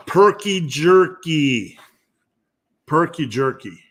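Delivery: slow, drawn-out mock Wisconsin accent; lightly put-on Wisconsin accent. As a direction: say it in a playful Wisconsin twang. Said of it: "saying that in my best Wisconsin accent".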